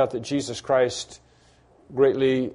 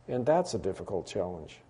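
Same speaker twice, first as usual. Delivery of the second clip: "that's a difficult challenge" dropped much lower and softer; 7 semitones lower, 11 dB quieter than usual. Real, not acted. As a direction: let "challenge" sink to a hush. Repeated line, much softer.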